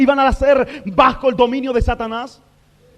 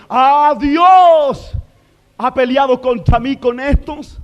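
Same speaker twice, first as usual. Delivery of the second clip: Satan gloating; 4 semitones higher, 5 dB louder than usual. Acted. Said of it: "yo creo que Satanás se rió y dijo".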